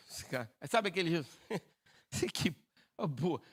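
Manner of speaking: demonstrating a scoffing chuckle mid-sentence; mocking laugh. Shown as a demonstration. Preacher showing how Sara laughed at the promise, mock scoffing laugh. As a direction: break into a disbelieving little laugh. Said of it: "Ela riu de deboche".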